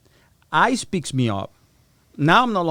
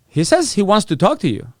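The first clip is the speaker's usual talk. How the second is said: ordinary talk, nothing marked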